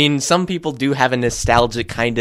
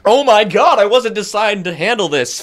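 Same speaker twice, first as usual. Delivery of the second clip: panicked body voice; 8 semitones higher than usual. Pretend.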